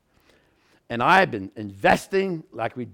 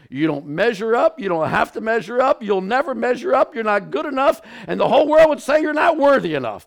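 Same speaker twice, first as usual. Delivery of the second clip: rising higher and louder to a peak; building preacher's climax; 7 semitones higher, 4 dB louder than usual. Real, not acted. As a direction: build the list to a crescendo.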